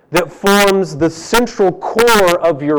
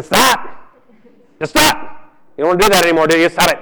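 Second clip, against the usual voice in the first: mock pastor yelling stop; 2 semitones higher, faster than usual. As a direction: nag someone to quit like a preachy pastor. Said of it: "I go".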